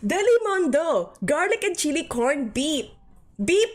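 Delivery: high, hammed-up Filipino-accent advert. Streamer retrying the mock advert, Filipino accent. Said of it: "That was my Filipino accent".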